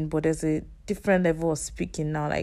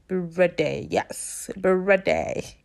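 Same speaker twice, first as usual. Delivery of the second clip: odd voice; 2 semitones higher, 3 dB louder, slower than usual. Real, not acted. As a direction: voice playfully out of shape on a drawn-out call. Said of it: "Sorry about my voice".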